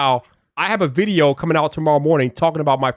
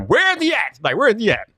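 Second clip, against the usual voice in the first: mock outrage; 7 semitones higher than usual. Real, not acted. Calling out for Technically T.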